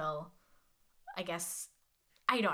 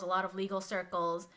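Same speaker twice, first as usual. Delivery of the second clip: ordinary talk, nothing marked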